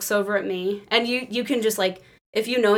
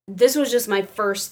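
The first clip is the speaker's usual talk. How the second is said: normal talking voice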